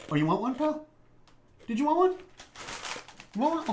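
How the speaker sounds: high-pitched rising questions; sing-song high coaxing; coaxing a pet. Real, not acted. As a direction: offer a treat to a little pal, voice way up. Offers a cookie to little pal.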